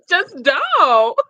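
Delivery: high, drawn out through laughter; laughing plea. Real, not acted. Telling [name] to stop dancing, laughing. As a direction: crack up while begging her to stop.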